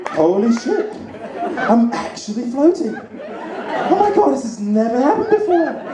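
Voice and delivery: high, laughing disbelief; amazed floating. Retold or imitated. Floating in tank for first time, mock amazed. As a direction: marvel at it giddily. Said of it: "I was like".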